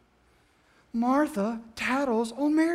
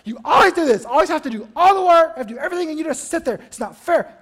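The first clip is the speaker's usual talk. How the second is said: loud, high angry outburst; Martha going off; 4 semitones higher, 5 dB louder than usual. Acted. Martha snapping at Mary in front of Jesus, furious rant. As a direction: snap and rant at your sister. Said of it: "she just goes off on Mary".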